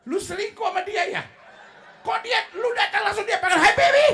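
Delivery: hammed-up jealous wife's shout; jealous wife yelling. Jealous wife accusing her husband, shouting.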